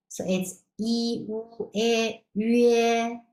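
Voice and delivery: yi, wu, yue said slowly as Mandarin syllables; pinyin syllables. Shown as a demonstration. Teacher sounding out yi, wu, yue for students. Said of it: "The pronunciation is the same".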